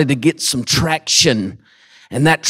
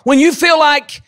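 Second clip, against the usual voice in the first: preaching; 9 semitones higher than usual. Acted. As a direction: build the sermon to a shout. Preaching at full force.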